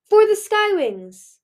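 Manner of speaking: high, loud and slow rallying shout; shouted battle cry. Acted. Tourmaline to the waiting crowd, loud slow triumphant shout. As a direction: roar it to the whole crowd.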